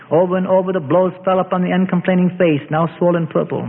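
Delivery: ordinary delivery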